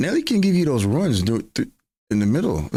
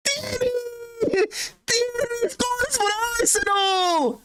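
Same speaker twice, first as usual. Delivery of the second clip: much higher Scottish goal shout, slow and stretched; a poor Scottish accent, roaring the goal; hammed-up Scottish commentator calling the goal; bad Scottish commentator; slower than usual. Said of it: "My Scottish accent is really bad".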